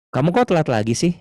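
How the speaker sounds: demonstrated everyday reprimand; scolding reproach. Shown as a demonstration. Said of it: "pasti kalau ngomong negur".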